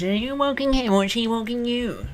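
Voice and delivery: high, sing-along busybody voice; mocking passers-by. Passer-by teasing a dog owner, mocking high voice. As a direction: put on a high, teasing onlooker voice. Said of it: "everyone always goes".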